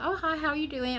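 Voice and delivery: high, bright-toned cashier greeting; chirpy cashier. Retold or imitated. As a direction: greet the shopper like a cheery cashier. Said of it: "the cashier says".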